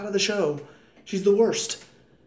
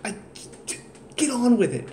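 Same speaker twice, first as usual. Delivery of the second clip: exasperated outburst; 3 semitones higher than usual. Retold or imitated.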